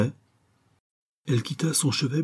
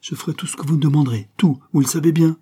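higher, louder eager vow; exclaimed promise; 7 semitones higher, 5 dB louder, faster than usual. A narrator's reading. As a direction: promise everything, building louder on the last words. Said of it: "s'écria-t-il".

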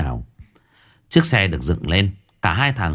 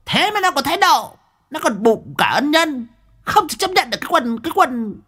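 put-on very high, sneering old loan shark woman; spiteful moneylender; 10 semitones higher than usual. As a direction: screech out her grievance, pitched way up. Moneylender Dung, shrill high voice. (mụ Dung cho vay nặng lãi, giọng the thé chua ngoa)

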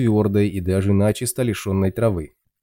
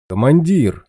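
louder living call; 6 semitones higher, 6 dB louder, slower than usual. Acted. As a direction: call out from afar. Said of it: "позвал живой невредимый стерх".

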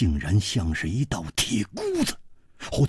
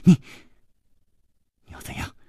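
hushed uneasy response; cowed; much faster than usual. Acted. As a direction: ask haltingly, almost voiceless. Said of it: "长长的吸进了一口气".

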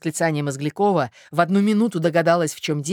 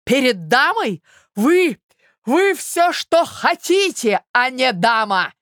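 high, loud, stretched-out insult; outraged cry; 9 semitones higher, 6 dB louder, much slower than usual. A narrator's reading. Suitor denies she is a lady, outraged cry. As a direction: blast her word back at her. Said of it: "вскричал Мозгляков".

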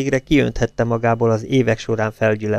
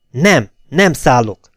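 breathless, slow, louder gasping refusal; panting; 3 semitones higher, 6 dB louder, slower than usual. A narrator's reading. Eszter panting defiance while hanging onto the wheel. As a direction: pant it out, clinging on. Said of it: "lihegte Eszter".

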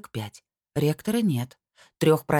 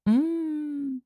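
delighted mmm; 12 semitones higher than usual. Acted. Savouring pancakes.

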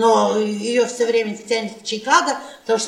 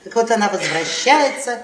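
usual speaking voice

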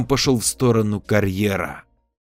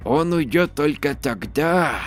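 creaky voice; 5 semitones higher than usual. Acted. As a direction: creak it out like an ancient crone. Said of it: "довольно поддержала".

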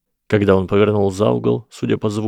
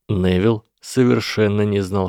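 plain reading voice

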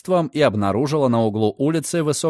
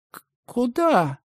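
high, slow, startled stammer; stunned stammer; 11 semitones higher, slower than usual. Acted. Stunned girl asks Redfern where, high stammer. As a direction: blink and stumble over the word.

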